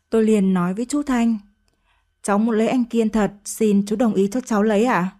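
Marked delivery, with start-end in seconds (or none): none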